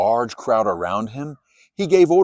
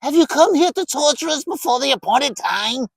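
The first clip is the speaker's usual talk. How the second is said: much higher, strange shrieking demon; 13 semitones higher, 3 dB louder than usual. A narrator's reading. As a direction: demand it in an eerie voice. Demons confront Jesus.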